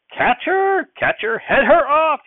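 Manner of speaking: high, loud frantic yell; shouting. Acted. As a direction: shout after the runaway calf. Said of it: "he was shouting".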